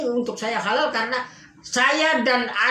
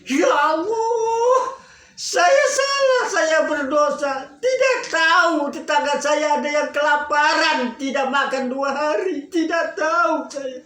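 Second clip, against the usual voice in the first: sobbing confession, much higher and louder; retold sobbing; 5 semitones higher, 4 dB louder than usual. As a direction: cry out to God in remorse, voice breaking. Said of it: "Nangis menjadi".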